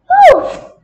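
high, loud barking woof. Acted. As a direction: bark it out loud. Cat's loud sound.

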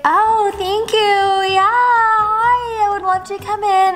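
very high, slow, sugary fake politeness; match her fake bubbly energy; fake phone voice. She answers the tanning spot's call, high fake sweetness. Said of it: "it's all so fake and fraudulent".

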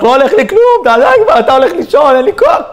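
very high-pitched caricature of a fed-up wife; mock wife yelling. Wife snapping over square meatballs on Friday, mock yelling. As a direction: screech out a hammed-up nagging rant, pitch leaping. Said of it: "התחילה לצעוק".

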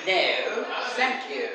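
cold Oxford English. Retold or imitated. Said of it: "fríamente en inglés, como un Sherlock Holmes".

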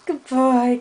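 normal talking voice

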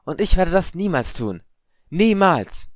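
high, loud vow; eerie accuser. A narrator's reading. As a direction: declare it high and forceful, as a ghostly accuser. Ghostly voice quotes a vow.

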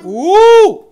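high, loud "uh" cry; startled whoop. Real, not acted. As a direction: yelp as you drop. Susto ao cair no jogo. (gritinho alto de susto)

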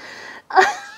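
high-pitched giggle; laugh. Real, not acted. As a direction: burst into embarrassed giggles. Laughing at own sniffing.

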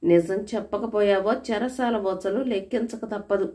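ordinary delivery, nothing marked

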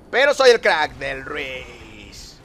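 slow, swaggering brag with a long tail; drawn-out boast. Pretend. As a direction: boast it, stretching RUIZ long and downward.

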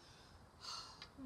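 soft, acted sad sigh; storybook hedgehog sighing; dejected sigh. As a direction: let out a breathy sigh of disappointment.

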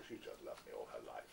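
calm low aside; said calmly. Retold or imitated. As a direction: say it quietly and offhand, unbothered. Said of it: "it's calmly, I said".

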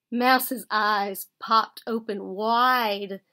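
ordinary delivery

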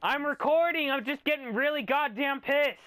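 loud, high, angry call to another room; shouting back. Real, not acted. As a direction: yell it through the door. Yelling back to mom.

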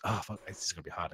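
usual speaking voice

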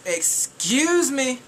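slow, drawn-out indignant excuse me; offended, slowed-down excuse me. Real, not acted. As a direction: stretch it out in disbelief. Outraged at VIP light stick.

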